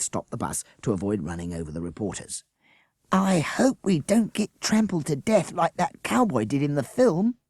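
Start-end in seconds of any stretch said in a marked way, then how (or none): 3.1-7.4 put-on high, a touch worried child; a nervous kid on the bus; lightly voiced fretful child. A narrator's reading.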